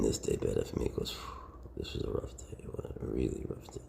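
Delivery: very soft, breathy whisper; hushed, intimate murmuring; bedtime whisper. Real, not acted. Talks softly beside sleepy snake.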